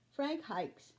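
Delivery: usual speaking voice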